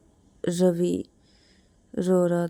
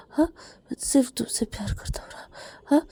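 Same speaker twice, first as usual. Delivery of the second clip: whisper; 6 semitones higher than usual. Acted. Whispered reassurance to crying lover.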